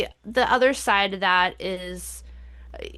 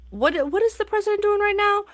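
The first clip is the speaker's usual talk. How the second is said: incredulous; 13 semitones higher, faster than usual. Real, not acted. Rhetorical outraged question.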